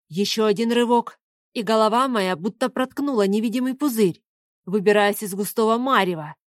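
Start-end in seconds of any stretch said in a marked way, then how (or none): none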